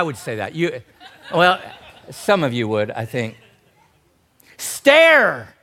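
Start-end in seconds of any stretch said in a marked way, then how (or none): 4.6-5.5 loud, high, stretched-out yell; emphatic drawn-out holler; shouted command. Acted. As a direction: bellow it as a one-word order. Modern rendering of shouted behold.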